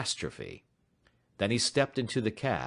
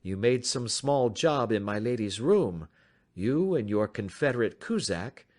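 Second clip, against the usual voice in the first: lightly voiced, slightly higher, courteous detective; polite and in command; composed detective; 6 semitones higher than usual. A narrator's reading. Detective inviting the stranger to talk, lightly voiced calm.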